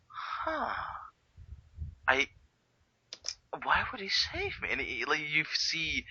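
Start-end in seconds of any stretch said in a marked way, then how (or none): 0.1-4.7 high, soft, halting bewilderment; baffled Joker. Retold or imitated. Retelling the Joker after being saved, baffled and halting. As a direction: puzzle it out softly in a raised pitch, with long pauses.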